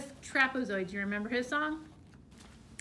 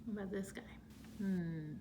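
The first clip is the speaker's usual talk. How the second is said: whisper; 3 semitones lower, 9 dB quieter than usual. Real, not acted. Teacher whispers a question.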